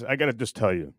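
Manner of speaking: normal talking voice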